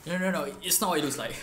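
quick flustered denial; caught red handed. Acted. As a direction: blurt it out, caught in the act. Caught with another woman, skit.

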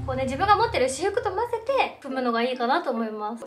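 ordinary delivery

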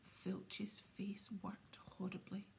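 ordinary delivery, nothing marked

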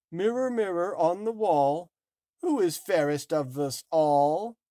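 high, loud commanding queen; wicked queen voice. Acted. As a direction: call to the mirror like a vain queen. Wicked queen addresses her mirror.

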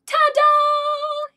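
high, loud "Ta-da!"; triumphant shout. Acted. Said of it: "he shouts".

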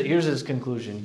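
normal talking voice

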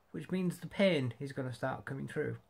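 usual speaking voice

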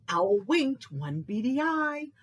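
usual speaking voice

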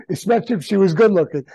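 chuckling through the words; laughing. Real, not acted. Guest jokes to the host about a pretty woman, laughing. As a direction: laugh while delivering the punchline.

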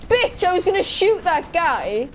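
high, loud complaint; indignant protest. Real, not acted. Player robbed of a shot, indignant outburst. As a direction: protest loudly at a stolen kill. Teammate stole the kill.

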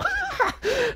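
squeaky high giggle; high-pitched wicked titter. Pretend. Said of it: "high giggle".

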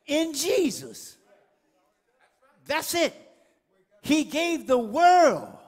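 slow, weighty, word by word; slow emphatic preaching. Acted. Preacher dwelling slowly on the gift. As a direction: let each word land slowly.

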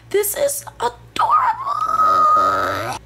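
high, breathy whispered coo. Real, not acted. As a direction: coo over something cute. Cooing over fuzzy snake toy.

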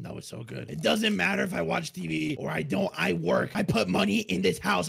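normal talking voice

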